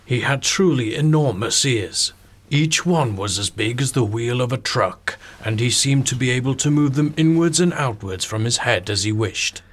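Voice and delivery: slow, dramatic storybook reading; story narration. Acted. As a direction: read it slow for a bedtime story. Reads children's book narration.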